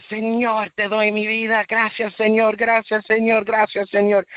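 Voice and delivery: high, fervent cry of thanks; ecstatic shouting. Retold or imitated. Young man in church cries his thanks, high and fervent. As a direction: call out to God in overwhelming joy. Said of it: "Y yo empecé a gris-, gritar".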